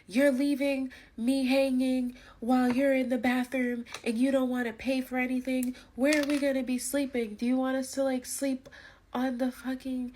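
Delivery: retold angry friend, pitched high; mock angry rant. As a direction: imitate her freaking out at you, high and shrill. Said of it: "she was basically like".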